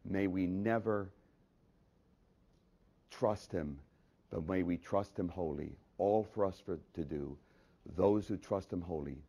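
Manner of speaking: soft, low pastoral prayer; hushed closing prayer with reverent pauses. Real, not acted. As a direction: pray quietly and low on the congregation's behalf.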